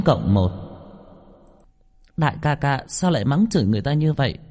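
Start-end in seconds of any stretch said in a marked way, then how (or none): 2.2-4.4 put-on high child voice, anger held back; boy restraining anger. A narrator's reading. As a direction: complain politely while holding in temper. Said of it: "cố gắng kiềm chế giận dữ trong lòng nói". (giọng bé trai cao, cố nén giận)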